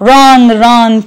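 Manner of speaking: ordinary talk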